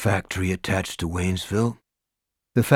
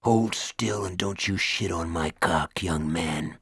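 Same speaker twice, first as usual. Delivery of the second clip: hushed threat in his ear, lower; low menacing whisper; 3 semitones lower, 6 dB quieter than usual. A narrator's reading. Prisoner menacing Morris, hushed low threat. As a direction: breathe it into his ear, cold. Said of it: "he whispered in Morris's ear".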